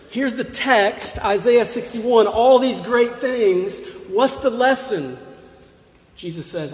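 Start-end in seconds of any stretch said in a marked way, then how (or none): none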